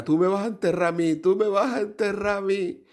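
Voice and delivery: hammed-up weeping wife; crying wife. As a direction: wail like a sobbing wife. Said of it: "la mujer empezó a llorar".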